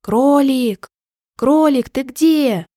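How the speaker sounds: worried, voiced stage whisper; anxious whisper. A narrator's reading. Bear searches for missing rabbit, anxious stage whisper. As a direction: call for a lost friend with mild anxiety. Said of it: "с тревогой зашептал".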